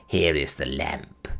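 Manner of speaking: low, slow, eerie crone voice; piping old witch. Acted. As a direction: croak it out like an ancient witch. Gagool hands the lamp.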